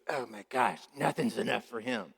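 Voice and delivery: put-on hurt little girl. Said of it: "Little her does and goes".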